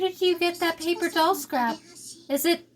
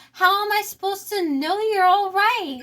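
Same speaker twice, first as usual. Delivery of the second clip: ordinary talk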